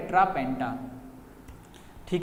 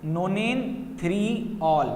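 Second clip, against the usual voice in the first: नोनेन three all read out very slowly; slow reading of name; much slower than usual. Shown as a demonstration. Teacher reads the compound name slowly to students. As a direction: spell the name out slowly, word by word. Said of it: "इस तरीके से हम पढ़ेंगे".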